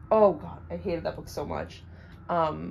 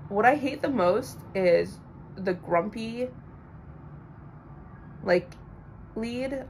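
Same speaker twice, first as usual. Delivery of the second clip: ordinary talk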